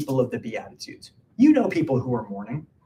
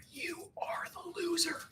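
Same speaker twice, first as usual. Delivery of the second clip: whisper; 15 dB quieter, slower than usual. Acted. Punchline dropped in a hush.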